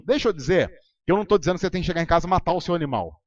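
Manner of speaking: normal talking voice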